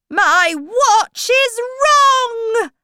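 high, loud, drawn-out complaint; angry. A narrator's reading. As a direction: stretch it out in loud storybook anger. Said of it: "Hatter said ... angrily".

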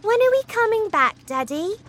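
put-on girl asking her father; anxious girl. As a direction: plead to know, panicking.

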